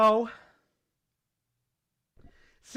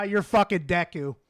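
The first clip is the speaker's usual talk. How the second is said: ordinary talk, nothing marked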